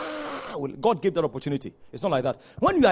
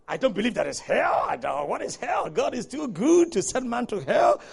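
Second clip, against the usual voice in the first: mock doubter; 5 semitones higher than usual. Mimicking people who deny hell.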